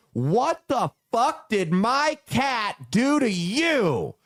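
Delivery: high, slow, drawn-out mock yell at a kid; drunk furious yell. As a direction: imitate the drunk woman screaming with a knife. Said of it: "pointing it at me and goes".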